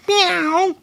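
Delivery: high, long mewing meow; cat meow. Retold or imitated. The gimp in the cat suit meowing from behind graves, high cat meow. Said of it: "and then it would say".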